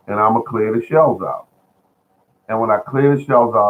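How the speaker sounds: normal talking voice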